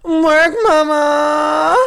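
a shrieked, high-pitched "Work, mama!"; diva shout. Acted. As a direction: belt it high and loud like a drag cheer. Show opener cheer.

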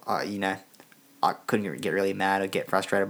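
ordinary talk

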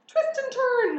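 very high, loud honk; honking goose. A narrator's reading. Goose ordering pig to dodge, honking. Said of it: "honked the goose".

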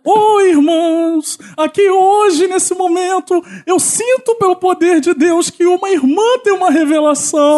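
preaching. Pretend. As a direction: preach it to the congregation. Pastor preaching to the congregation.

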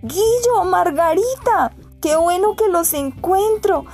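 overjoyed greeting; joyful shout. Acted. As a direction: call out happily to friends. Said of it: "gritó Andrés dichoso".

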